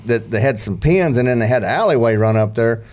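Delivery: ordinary talk, nothing marked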